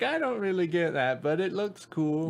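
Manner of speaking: clueless fan voice. Retold or imitated. Quoting own confused reaction.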